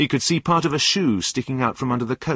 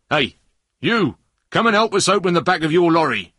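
loud, raised-pitch order; shouted; 8 semitones higher, 7 dB louder than usual. A narrator's reading. Policeman ordering the driver to come and help, shouted. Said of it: "shouted one of the policemen".

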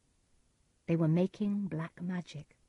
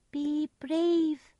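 put-on much higher child's voice; 10 semitones higher than usual. A narrator's reading. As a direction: speak as a crowned child. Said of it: "it said (crowned child)".

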